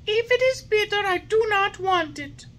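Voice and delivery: put-on high, loud whiny boy voice; stubborn puppet. A narrator's reading. Puppet refusing the fairy's medicine. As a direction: refuse like a spoilt child.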